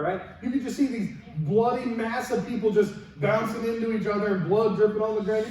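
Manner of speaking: ordinary delivery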